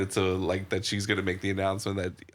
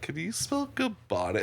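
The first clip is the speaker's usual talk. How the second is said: pitched way up, teasing question; mock caller asking brightly; high mock voice; 9 semitones higher, 3 dB quieter than usual. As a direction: squeak it out high and silly.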